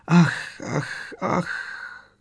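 breathless ach fading into bare breath; gasping. A narrator's reading. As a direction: gasp out each ach as air runs out. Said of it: "zadykala se paní Weasleyová". (zadýchaně, lapavě)

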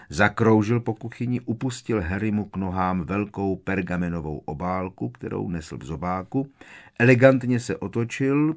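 ordinary delivery, nothing marked

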